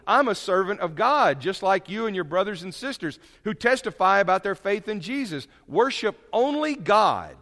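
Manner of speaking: loud, high scripture proclamation; preaching scripture reading. Acted. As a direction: declare it like a sermon climax. Reading scripture aloud forcefully.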